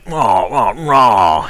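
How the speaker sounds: a light, gritty British tough-guy growl; mock-gruff action star grunting; gruff Jason Statham. Mimicking the British action star, a lightly gritty mock growl. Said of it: "super grimy for a British accent".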